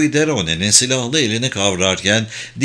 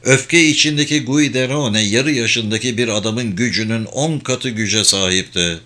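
plain reading voice

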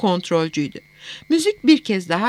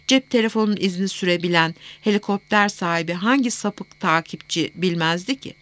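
ordinary delivery, nothing marked

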